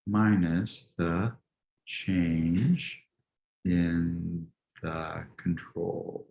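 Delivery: very slow, drawn-out words with long pauses; stretched, halting dictation to oneself; writing-out pace. Real, not acted. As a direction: speak slowly as if writing each word. Writing the formula out aloud.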